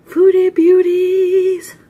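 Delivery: high, slow, loud stretched-out channel greeting; loud sing-song greeting. Acted. Vlogger hamming up the intro to viewers, high and stretched. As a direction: belt the channel name like a showman. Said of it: "vlog greeting".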